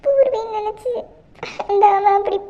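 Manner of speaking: ordinary talk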